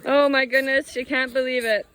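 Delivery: bright delighted gush; excited. Real, not acted. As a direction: gush over the calf. Excited over calf drinking.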